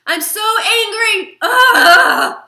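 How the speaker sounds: full-throated angry cry; angry shout. Shown as a demonstration. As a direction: shake it out with a raging cry. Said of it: "you can shake, like".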